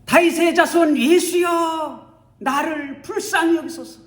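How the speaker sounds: high, loud desperate plea; crying out loud. Acted. Blind beggar crying out to Jesus, loud and high. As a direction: cry out for mercy at the top of his voice. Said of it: "혼자서 고래고래 소리를 지릅니다".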